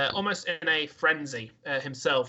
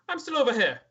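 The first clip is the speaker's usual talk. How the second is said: lightly Irish drow; 9 semitones higher than usual. Acted. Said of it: "Jim Jar's accent there".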